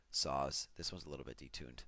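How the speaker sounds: ordinary talk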